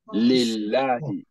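Lillahi drawn out as "lil-LAA-hi"; slow Lillahi with a thin L and open "ah"; light Lam. Shown as a demonstration. Teacher giving another Kesra example, light Lam. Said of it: "anytime Kesra is coming before it".

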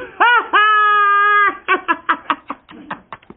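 loud, high cackling laugh; mocking laugh. Boy mocking the fooled villagers, loud cackle. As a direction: throw back your head and cackle.

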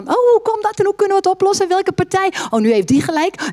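high, fast worried chatter, hammed up; mocking a fretful believer who frets over every quarrel; mock fretting. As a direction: play the anxious worrier, rushing. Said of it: "Ga niet denken van".

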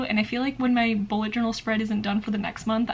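ordinary delivery

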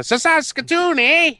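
high, playful Canadian twang on Saskatoon, eh; mock Canadian "eh". Suggesting a city, in a joking Canadian voice. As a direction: toss out a cartoonish Canadian eh.

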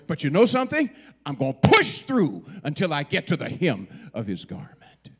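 loud, punched declaration easing at the end; fierce determination. Acted. Woman blocked by the crowd, determined to reach him. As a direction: drive through "push" with force.

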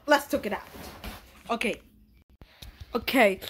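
usual speaking voice

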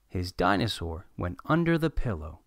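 plain reading voice

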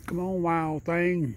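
high, slow sing-song coax to the raccoon; slow coaxing call. Real, not acted. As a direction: draw it out gently to lure a wild animal.

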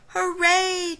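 loud high joyful cheer. Acted. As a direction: cheer with relief and joy. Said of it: "cheered the fish".

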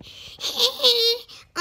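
high, loud burst of giggling; high giggle. Real, not acted. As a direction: let out a squealing laugh. Laughs at puppet reveal.

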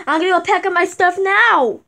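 ordinary talk, nothing marked

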